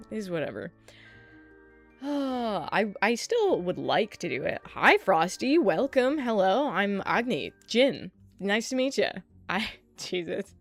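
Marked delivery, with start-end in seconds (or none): none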